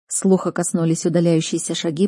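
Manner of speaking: plain reading voice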